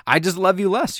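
hammed-up parent's verdict; mock parental rejection. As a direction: play a parent bluntly rejecting a kid. Mock parent rejecting the lesser kid.

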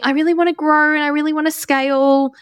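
a hint of an over-eager client; imitating eager clients. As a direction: mimic a client gushing about growth. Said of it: "oftentimes people tell me".

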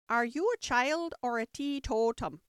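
sharp, impatient question; scolding Sheep. A narrator's reading. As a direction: demand it as a cross old Sheep. Narrator voices the scolding Sheep.

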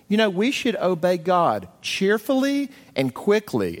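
a light mock of a preachy kid; imitating a child. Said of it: "the child looks up at mom and says".

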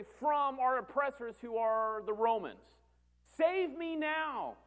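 high, insistent pleading; acted crowd complaint. Crowd listing grievances against the Romans, high insistent plea.

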